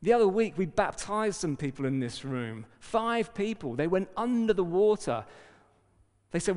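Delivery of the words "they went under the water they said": ordinary talk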